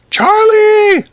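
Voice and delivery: loud, very high shriek for help; shouted call. A narrator's reading. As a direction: scream for your husband to come. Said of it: "shouted Missus Newcomb".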